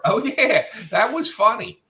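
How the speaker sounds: high, bright, excited oh yeah; genuinely amused. Retold or imitated. Imitating Adam's reply as delighted and sincere. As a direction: sound like you truly loved it.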